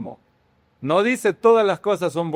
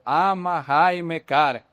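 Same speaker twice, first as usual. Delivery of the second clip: slow word-by-word example; slower than usual. Shown as a demonstration.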